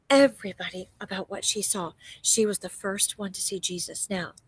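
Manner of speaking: usual speaking voice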